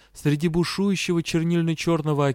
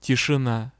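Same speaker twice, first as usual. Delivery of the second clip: usual speaking voice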